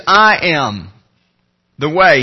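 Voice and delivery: "I am... the way" with a pause, the word "way" set apart; pause, then land firmly on "way"; stress on way. Shown as a demonstration. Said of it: "He puts an emphasis on it".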